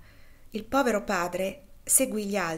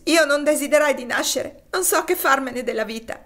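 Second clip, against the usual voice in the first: grieving cry; 6 semitones higher, 9 dB louder than usual. Acted. Topsy in grief at deathbed.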